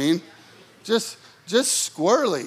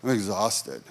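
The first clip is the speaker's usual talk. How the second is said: much lower and much softer, drained and sinking; worn-out complaint; 12 semitones lower, 8 dB quieter than usual. Retold or imitated. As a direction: let it sag like you have nothing left. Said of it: "I was like".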